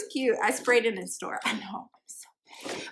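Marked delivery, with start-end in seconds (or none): 1.4-2.9 soft, whispered guilty aside; hushed playful confession. Real, not acted. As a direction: whisper it like a naughty admission.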